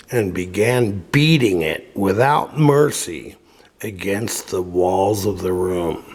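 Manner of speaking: plain reading voice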